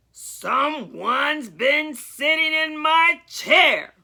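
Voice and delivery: slow, mildly grumbled big bear voice; Papa Bear finding his chair used, slow grumble; grumbling Papa Bear. A narrator's reading. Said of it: "grumbled Papa Bear".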